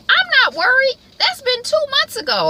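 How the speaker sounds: very high, loud, breezy dismissal; squeaky Beth impression. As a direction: shrug it off up in a piercing high voice. Voicing Beth's reply.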